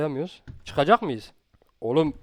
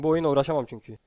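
normal talking voice

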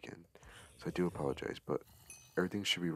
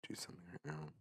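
whispering so the parents sleep; hushed late-night whisper; quiet at night; 4 dB quieter than usual. Real, not acted. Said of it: "I'm gonna be whispering the entire time".

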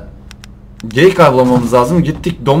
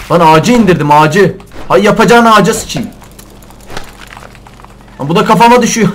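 loud, high cursing rant; real loud exasperation; exasperated yelling; 6 semitones higher, 7 dB louder than usual. Real, not acted. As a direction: shout it in disbelief. Tree falls on him.

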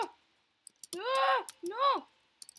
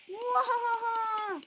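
evil laugh; 3 semitones higher, 4 dB louder, much slower than usual. Pretend. Villain laugh.

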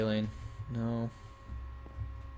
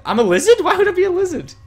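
high, loud outraged squawk; indignant surprise; 12 dB louder than usual. Real, not acted. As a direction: act offended, squeal the question. Mock outrage at being called lizard.

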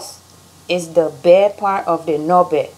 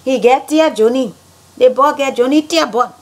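higher Liberian English; Liberian accent; 5 semitones higher than usual. Shown as a demonstration. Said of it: "how Liberians say things".

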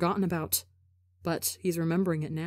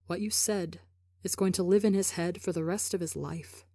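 ordinary delivery

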